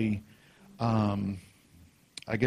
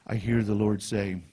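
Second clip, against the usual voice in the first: ordinary delivery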